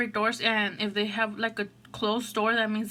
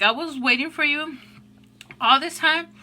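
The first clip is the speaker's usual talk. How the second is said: louder and higher, mock-exasperated complaint; half-joking annoyance; 5 semitones higher, 5 dB louder than usual. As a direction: scold her playfully, almost shouting. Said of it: "at first I was, like, kinda, like, pissed".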